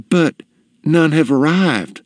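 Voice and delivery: put-on high, hesitant shop girl; mildly frightened girl, halting; flustered, fearful. A narrator's reading. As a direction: falter nervously before a feared customer. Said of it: "The girl grew flustered, for she feared".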